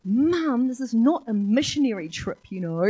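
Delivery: loud, high mock telling-off from her son; teenage son protesting loudly; son's exasperated protest. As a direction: play the son, loudly fed up with mum. Son scolding mum, retold.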